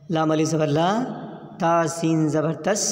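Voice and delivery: ordinary talk, nothing marked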